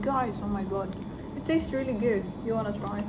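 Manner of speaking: ordinary delivery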